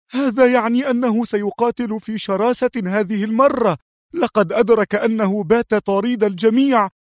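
high, urgent female voice; fearful exclaiming. A narrator's reading. Sonia warning the gang of his revenge, high and frightened. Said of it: "وهي تهتف مردفة".

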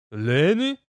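dwarf asking in a strange voice; odd, questioning dwarf voice. Acted. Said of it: "каким-то странным голосом переспросил гном".

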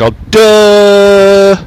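loud, high, sustained "duh" like a horn; mocking honk imitation. Man mocking honking drivers, loud honk imitation. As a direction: hold a dopey honk on one note. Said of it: "Every time someone honks".

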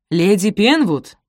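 loud, slow formal call. A narrator's reading. Butler interrupts the mistress mid-sentence, calling out. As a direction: announce the lady's name, drawn out.

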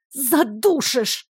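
slow, strained protest of being crushed; half-choked, stretched-out complaint; squeezed wheeze. A narrator's reading. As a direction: squeeze the word out, drawn long. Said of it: "придавленно просипела я".

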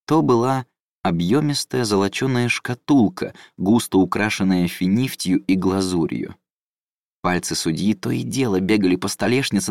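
ordinary delivery, nothing marked

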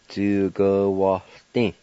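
Djugawaldi with a faint H before the L; slow careful repeat. Shown as a demonstration. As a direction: draw out Djugawaldi slowly. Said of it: "Let me pronounce it one more time".

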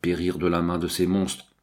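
ordinary delivery

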